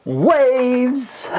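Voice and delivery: bright high-pitched call of the word; excited greeting. Real, not acted. Teacher greeting students, excited high call. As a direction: sing out a cheery hello to the class.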